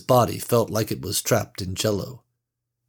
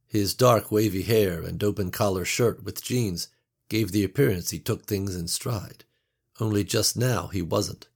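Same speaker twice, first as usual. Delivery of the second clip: usual speaking voice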